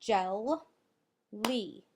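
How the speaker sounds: Gel, lee as two separate beats with a clear gap; tapped-out syllables. Shown as a demonstration. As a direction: tap out Gel ... lee, each syllable on its own. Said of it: "let's tap it out again".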